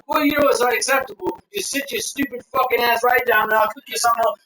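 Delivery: retold chef's scolding, pitched high; mock angry tirade. Chef tearing into a diner, mock angry tirade.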